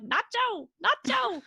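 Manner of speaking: high, loud, falling calls of a name; calling the cat with rising panic; imitated distraught owner. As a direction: owner bending down to his cat. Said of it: "and he's like".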